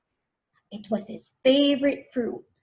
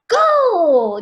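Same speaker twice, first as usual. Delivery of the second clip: high, loud starting shout; announcer blast; 7 semitones higher, 9 dB louder than usual. Acted. Announcer starts the race, blasting it. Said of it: "the announcer blasted".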